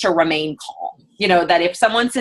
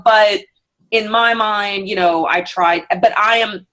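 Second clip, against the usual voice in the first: usual speaking voice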